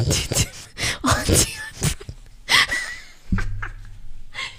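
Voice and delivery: high, squealing laughing fit; helpless laughter. Real, not acted. Streamer exposing an embarrassing chat, helpless high laughter. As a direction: laugh till it turns into a squeal. Cracking up at own chat message.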